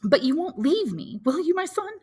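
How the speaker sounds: mother fearing for her son's future; voiced mother Mercédès.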